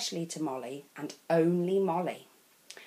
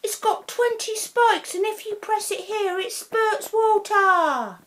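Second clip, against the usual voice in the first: Russell; 14 semitones higher, 6 dB louder than usual. A narrator's reading. Russell boasting about toy.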